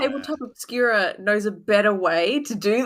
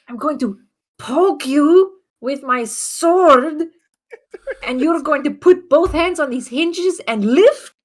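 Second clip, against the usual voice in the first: slow, raised, over-emphatic delivery; heavy hinting; 5 semitones higher, 4 dB louder, slower than usual. Acted. Adventurer hints an escape plan to the caged ogre, heavily pointed. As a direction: stretch key words with an audible wink. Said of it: "How many eyes were winking?".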